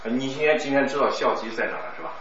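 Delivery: ordinary delivery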